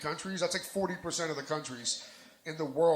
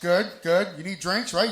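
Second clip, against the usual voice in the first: ordinary delivery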